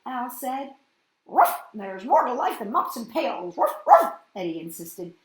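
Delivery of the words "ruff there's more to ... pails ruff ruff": character barking ruff between words; woofy dog character, loud barks; loud dog-like barking speech. Acted.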